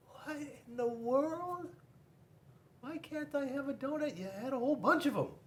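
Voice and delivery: can't believe he's refused; baffled penguin. Acted.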